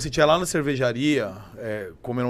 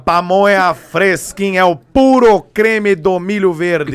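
loud, slow, drawn-out street vendor's call; pamonha car vendor; 4 semitones higher, 9 dB louder, much slower than usual. Acted. Imitating the pamonha car for his friends, loud vendor chant. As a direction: boom it out like a van loudspeaker pitch. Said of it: "Como é que é o carro da pamonha".

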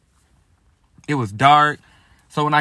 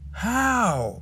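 high, soft incredulous how; a breathy squeak of disbelief; high, hushed how at the burnt cabinets; 8 semitones higher, 6 dB quieter than usual. Retold or imitated. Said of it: "looking in disbelief".

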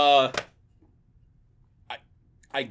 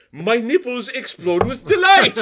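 higher, louder, slow and proud; hammed-up phrasebook tourist; 10 semitones higher, 6 dB louder than usual. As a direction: announce it with a straight face. Quoting the phrasebook line.

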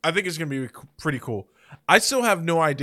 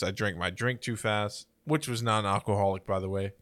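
ordinary delivery

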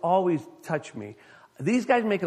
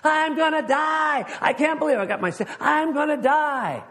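hammed-up terror of dying; shaky stammering panic; 13 semitones higher, 6 dB louder than usual. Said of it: "That was me last week".

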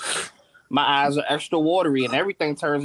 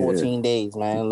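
ordinary delivery

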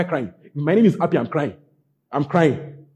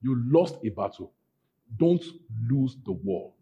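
normal talking voice

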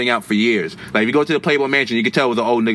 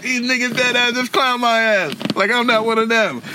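stung realization; 10 semitones higher than usual. Retold or imitated. Kid laughed at by soldiers, high stung disbelief. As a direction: realize you got clowned. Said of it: "So I'm like".

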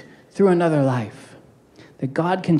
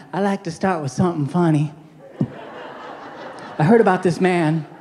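Joel Osteen impression; 2 semitones lower than usual. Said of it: "You don't have to sound like Joel Osteen".

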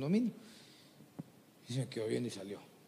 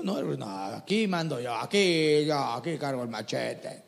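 proud bully; 4 semitones lower, slower than usual. Acted. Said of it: "Pero el orgulloso".

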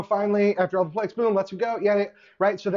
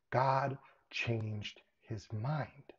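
slow emphatic point; 6 semitones lower, 10 dB quieter, much slower than usual. Real, not acted. Preacher drives home his point.

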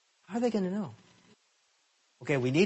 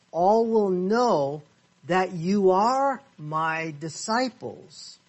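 slow reading; 3 semitones higher, much slower than usual. Shown as a demonstration. Reading verse slowly.

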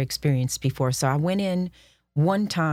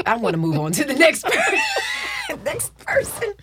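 laughing; slower than usual. Retold or imitated. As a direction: laugh it out, pitched way up. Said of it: "Miss Jays just was like".

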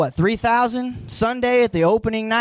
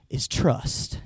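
1 hushed reveal; 7 semitones lower, 6 dB quieter than usual. Acted. Sermon topic reveal.

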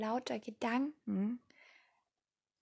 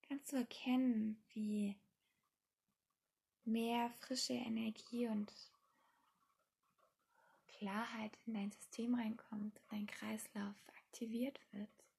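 guided meditation; 8 dB quieter than usual. Acted. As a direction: ease the listener into calm, softly.

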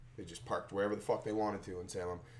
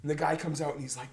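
ordinary delivery

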